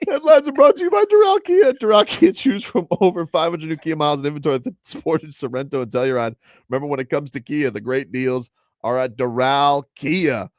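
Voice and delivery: loud, high sponsor read, hammed up. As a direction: sell the dealership like a radio spot.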